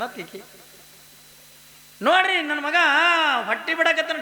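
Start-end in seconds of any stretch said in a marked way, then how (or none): 2.0-4.2 high, loud village mother's cry; a fretting mother raising the alarm; worried mother. Retold or imitated. Worried mother telling neighbours. (ಆತಂಕದ ತಾಯಿಯ ಎತ್ತರದ ಕೂಗು)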